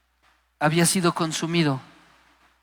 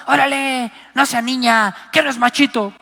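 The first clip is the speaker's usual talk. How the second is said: mocking macho father; 6 semitones higher, 5 dB louder than usual. Padre macho regaña al hijo.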